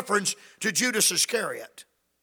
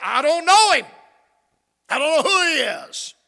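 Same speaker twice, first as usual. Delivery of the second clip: vehement denial; 5 semitones higher, 4 dB louder than usual. Acted. Peter denying Jesus.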